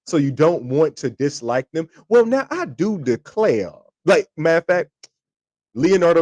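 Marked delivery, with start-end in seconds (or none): 2.1-3.7 high, slow, charming Southern twang; Southern drawl. Retold or imitated. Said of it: "has a Southern twang".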